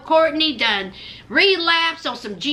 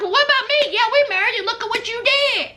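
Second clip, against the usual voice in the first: imitating Will; 7 semitones higher, much faster than usual. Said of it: "He was like".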